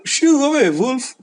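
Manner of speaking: high, loud chasing-off shout; shooing away. Acted. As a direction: drive the wolf off loudly. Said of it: "they told the wolf to run away".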